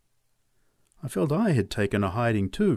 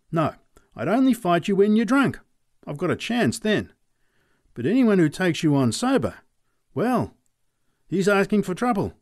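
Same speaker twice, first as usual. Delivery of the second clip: higher, louder voice of a wary farmer backing off; Charlie Robbins; 7 semitones higher, 4 dB louder than usual. Acted. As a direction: plead your way out of a fight, pitched up. Robbins refuses to fight.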